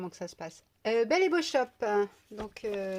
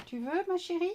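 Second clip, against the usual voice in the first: high-pitched, affectionate question to a pet; coaxing pet talk; 8 semitones higher than usual. Real, not acted. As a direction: coax a little pet in a high voice. Talks to her pet Tounette. (voix aiguë et câline pour un animal)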